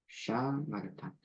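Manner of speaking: 느리게 또박또박 "샤마르타."; 끝을 내리며 천천히 "샤마르타". Shown as a demonstration. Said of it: "샤마르타가 됩니다". (느린 발음 시범)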